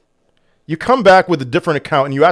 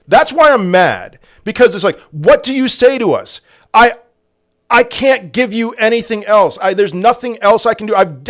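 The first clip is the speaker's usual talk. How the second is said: higher and louder, exasperated and pleading; mad; 8 semitones higher, 3 dB louder than usual. Real, not acted. Streamer defending himself to a viewer who lost money, real anger. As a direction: vent real frustration at being blamed. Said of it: "So I'm getting mad again".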